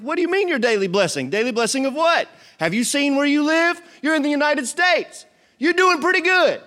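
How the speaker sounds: high, loud mock outrage building; exasperated God. God scolding a spoiled believer, high and hammed up. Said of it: "he's like".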